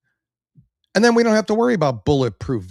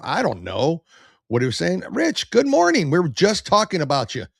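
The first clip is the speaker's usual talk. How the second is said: ordinary talk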